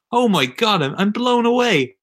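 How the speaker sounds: high, thrilled gush; excited. Real, not acted. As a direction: sound truly blown away. Raving about the match.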